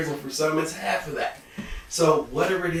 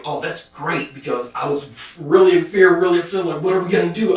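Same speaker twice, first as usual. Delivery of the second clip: louder, upset voice of the emotional self; a rattled inner voice, louder; anxious emotional self; 4 semitones higher, 9 dB louder than usual. Acted. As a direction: play the worried emotional self. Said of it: "Your emotional self is gonna be".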